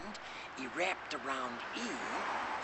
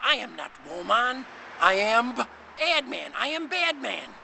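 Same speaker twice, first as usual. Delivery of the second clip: boastful man; 6 semitones higher, 7 dB louder than usual. Acted.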